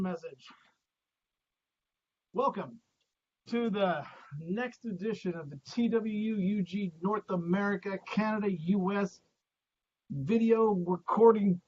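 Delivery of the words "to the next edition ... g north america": ordinary talk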